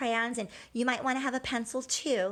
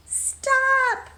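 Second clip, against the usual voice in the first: loud call; 7 dB louder than usual. Acted. McGregor yelling at Peter.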